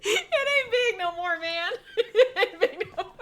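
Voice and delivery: high squealing laughter through the words; can barely get it out for laughing; laughing hard. Real, not acted. Cracking up at her co-host's gap tooth joke.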